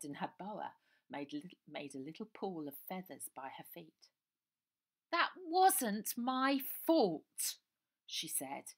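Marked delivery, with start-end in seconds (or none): 5.1-7.6 high, very slow, loud posh English protest; posh West End accent. Acted. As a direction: put on a plummy West End accent, indignant. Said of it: "in a posh voice like you heard up west".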